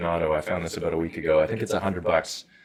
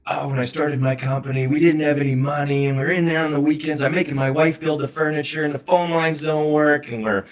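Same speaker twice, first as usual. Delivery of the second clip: lightly voiced proud business owner, higher; a hint of a nostalgic old client recalling hard times; reminiscing client; 6 semitones higher than usual. Advisor imitating a client, nostalgic and lightly voiced.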